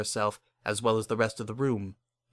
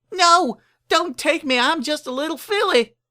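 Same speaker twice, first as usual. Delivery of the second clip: terrified filly; 7 dB louder than usual. Acted. Filly waking from a faint, pleading with the ghost in terror. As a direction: beg like a little filly snatched by a ghost.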